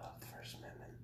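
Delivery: whispered aside; whispering. Real, not acted. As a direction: breathe it unvoiced.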